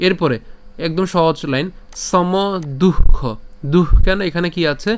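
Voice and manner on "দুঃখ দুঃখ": "দুঃখ" with a loud puff of warm breath after du; forceful, aspirated "দুঃখ"; breathed visarga. Shown as a demonstration. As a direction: release air from the mouth midway through "দুঃখ". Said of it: "উষ্ম ছাড়তে হবে বিসর্গ থাকলে".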